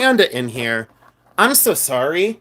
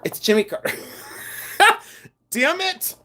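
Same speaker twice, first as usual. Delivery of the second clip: cracking up, high-pitched; helpless giggle while talking; laughing; 3 dB quieter than usual. Real, not acted. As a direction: break into real laughter mid-line. Cracks up over wrong guess.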